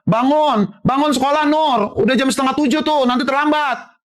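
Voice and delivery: high-pitched calling to a sleeper; wake-up call. Acted. Father waking child for school, high sing-out. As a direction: call out insistently to rouse a child.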